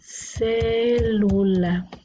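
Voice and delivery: "Célula" slowly, with a long held CÉ; stretched stressed syllable. Shown as a demonstration. Teacher writing the accent, "Célula" with lengthened first syllable. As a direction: draw out CÉ in "Célula", then lu-la. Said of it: "el acento en la sílaba tónica".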